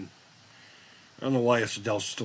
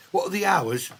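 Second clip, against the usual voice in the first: lightly higher, prim clerk; shoe salesman impression; 8 semitones higher than usual. Spinal Tap guitarist playing a shoe clerk, light prim voice. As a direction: ask it like a polite shop clerk. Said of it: "pretending to be a shoe salesperson".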